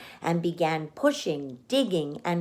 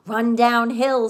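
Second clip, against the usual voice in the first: louder cow call; cows suggesting; 4 semitones higher, 7 dB louder than usual. A narrator's reading. The cows calling advice to Wilbur. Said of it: "suggested the cows".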